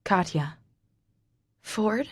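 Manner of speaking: plain reading voice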